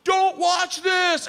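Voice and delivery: slow, high, loud warning; loud warning at the door; urgent warning. Retold or imitated. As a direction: warn him hard as she goes. Warning before jumping.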